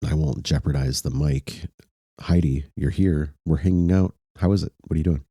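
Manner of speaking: normal talking voice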